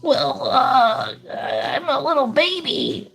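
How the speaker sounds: very high, squeaky cartoon baby voice; Tommy Pickles baby voice. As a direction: play a little cartoon toddler, high and sweet. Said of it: "And then Tommy, if I remember, had, like, that".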